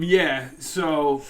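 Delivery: ordinary talk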